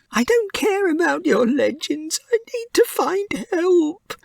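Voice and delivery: a little mouse distraught over his dying friend; young mouse Pip. A narrator's reading. Pip refuses the legend.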